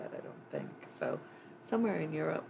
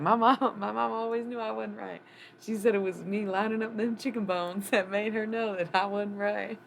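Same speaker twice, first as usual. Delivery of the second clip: put-on Texas accent; 6 semitones higher, 6 dB louder than usual. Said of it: "in that weird Texas accent".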